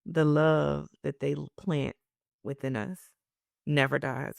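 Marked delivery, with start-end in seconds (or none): none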